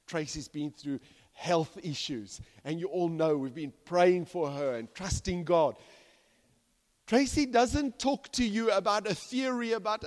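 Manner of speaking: ordinary delivery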